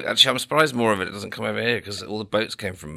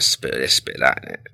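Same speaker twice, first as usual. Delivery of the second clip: low-pitched mock Cockney; half-joking Cockney impression, pitched down; Cockney accent; 6 semitones lower, 5 dB louder, much slower than usual. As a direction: have a go at Cockney. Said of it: "What, what a Cockney".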